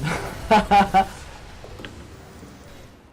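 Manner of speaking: loud breathy laugh; hearty laugh. Real, not acted. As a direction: burst out laughing. Laughing at opponent.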